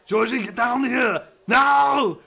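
action-star shout. Acted. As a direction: roar it like a movie strongman. Schwarzenegger Pennywise impression.

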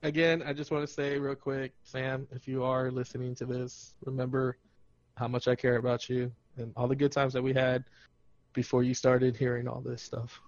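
low, soft, sincere address; earnest appeal. Acted. Man to Sam listening to podcast, earnest appeal. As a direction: speak softly as if straight to them, setting up a joke.

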